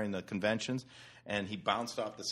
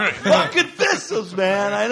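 louder, higher, slow, laughing; mock drunken agreement; 12 semitones higher, 13 dB louder, slower than usual. Drunk card player agreeing about thistles, loud and laughing. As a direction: slur the commiseration.

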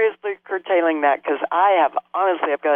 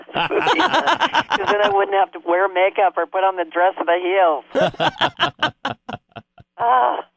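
laughing; 3 semitones higher, slower than usual. Real, not acted. Laughing at her own nun joke.

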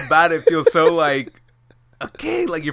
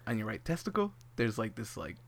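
much softer, lower grave aside; hushed doctor news; 7 semitones lower, 18 dB quieter than usual. Retold or imitated. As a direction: break the news quietly like a careful doctor. Doctor delivering worrying news.

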